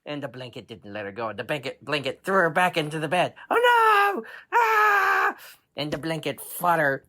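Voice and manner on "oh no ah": very high shouted shriek; girl's panicked scream. Acted. Girl thrown back into bed, very high panicked scream.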